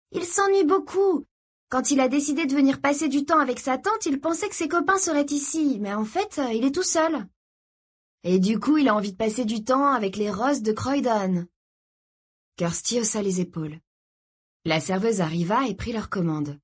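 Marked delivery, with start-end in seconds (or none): 0.1-11.5 put-on high, girlish character voice; earnest young sister, pitched up; girl defending a friend. A narrator's reading. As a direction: plead his case in a high voice. Girl defends her new friend.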